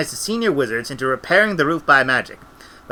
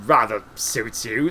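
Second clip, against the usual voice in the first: slow, low character voice; low, unhurried remark in character; Cutangle's compliment; 5 semitones lower, 3 dB quieter, slower than usual. A narrator's reading. Cutangle admiring Granny's hat, low and slow.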